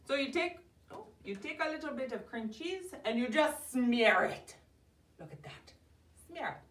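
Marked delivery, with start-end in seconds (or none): 5.2-5.7 breathless hushed whisper. Pretend. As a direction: whisper it like a secret. Shows off the smeared cheese.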